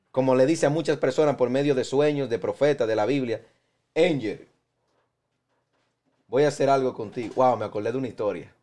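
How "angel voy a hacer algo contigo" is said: voice of God. Acted. God tells Angel of His plan, in a hint of a divine voice. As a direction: speak a little lower, as God calling someone. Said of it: "porque hablé como Dios".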